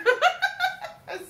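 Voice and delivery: shrieking burst of laughter; high loud laugh. Real, not acted. Reader bursting into laughter at the cards. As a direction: cackle out loud.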